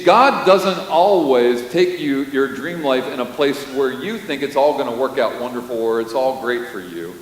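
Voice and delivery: ordinary talk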